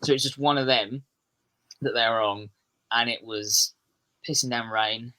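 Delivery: normal talking voice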